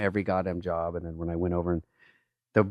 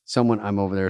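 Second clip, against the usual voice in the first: ordinary talk, nothing marked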